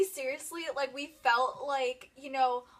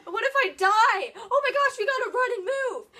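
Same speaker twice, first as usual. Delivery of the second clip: panicked; 6 semitones higher, 9 dB louder than usual. Retold or imitated. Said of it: "and we're like".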